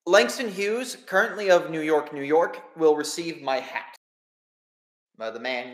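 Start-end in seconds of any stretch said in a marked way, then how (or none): 0.1-3.9 formal, measured reading of a will; solemn will reading. Acted. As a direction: read it out like a lawyer, deliberate. Game master reads the will.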